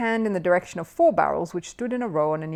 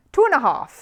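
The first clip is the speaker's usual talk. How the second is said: firm; 8 semitones higher, 8 dB louder than usual. A narrator's reading. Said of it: "said Paddington firmly".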